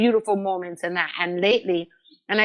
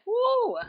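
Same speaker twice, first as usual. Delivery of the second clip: emotional whoo. Real, not acted. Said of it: "I feel like".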